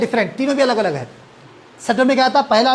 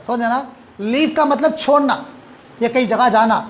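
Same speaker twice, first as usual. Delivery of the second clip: normal talking voice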